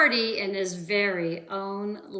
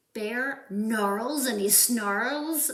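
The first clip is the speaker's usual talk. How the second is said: ordinary delivery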